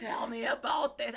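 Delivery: mild mock yell; mock angry demand. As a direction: act out hangry impatience. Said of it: "I was angry".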